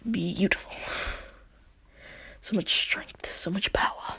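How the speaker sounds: soft, breathy marvelling; hushed awe. Acted. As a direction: whisper it in mock reverence. Awed reaction to great power.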